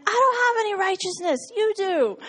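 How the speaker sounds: high, loud, quick indignant protest. Retold or imitated. Telling the Lord she has no righteousness, high indignant protest. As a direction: fire it back as a helpless complaint. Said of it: "And I'm like".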